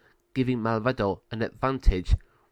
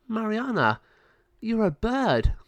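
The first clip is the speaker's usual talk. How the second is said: amazed; 11 semitones higher than usual. Acted. Amazed at the girl.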